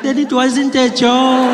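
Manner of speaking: high, loud, drawn-out granny verdict; dismissive grandmother. Retold or imitated. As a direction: imitate granny ruling it out, slowly. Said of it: "She's like".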